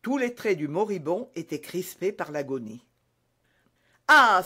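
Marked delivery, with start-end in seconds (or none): 4.1-4.4 loud, high outcry; cry of horror. A narrator's reading. Dying soldier catches sight of D'Artagnan, crying out. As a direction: cry out at the sight of your poisoner. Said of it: "s'écria-t-il".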